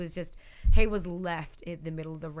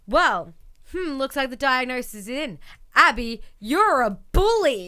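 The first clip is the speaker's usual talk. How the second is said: raised pitch, stretching the final verdict; playful mock doctor; 5 semitones higher, 6 dB louder than usual. Host acts Bray diagnosing Abby, playful mock doctor. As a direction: deliver a hammed-up diagnosis, ending louder.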